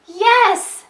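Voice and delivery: high, loud exclamation; big storybook shout, word by word; excited yell. A narrator's reading. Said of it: "yells Max".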